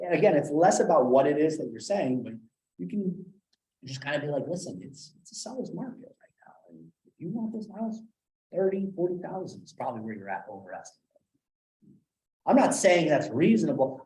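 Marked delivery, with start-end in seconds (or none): 4.5-11.0 insinuating tone. Shown as a demonstration. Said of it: "I'm just insinuating".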